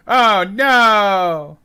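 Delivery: loud, high mock wail; dramatic cry. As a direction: wail it out in fake despair. Mock despair at no points.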